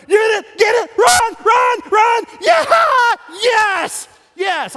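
high, loud frantic cheering; screaming sports fan. Acted. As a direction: yell them on from the stands. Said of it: "you go to the stadium, you're like".

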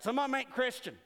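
a hint of a rural Southern delegate; lightly put-on folksy grumble; country drawl. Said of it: "There's usually a guy who talks like that".

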